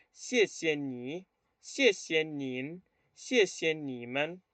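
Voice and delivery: "Xie xie ni, xie xie nin, xie xie ni men" drawn out; slow, careful "Xie xie" phrases; model pronunciation. Shown as a demonstration. Teacher reviewing thanks with the class, slow model pronunciation. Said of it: "primero a pronunciar estas palabras".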